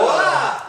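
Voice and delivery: short high loud yelp of surprise; a playful shriek as the energy hits you; startled yelp. Shown as a demonstration. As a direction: jolt back with a cry. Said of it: "he's gonna go".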